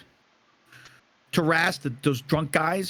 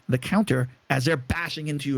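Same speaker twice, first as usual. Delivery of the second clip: ordinary talk, nothing marked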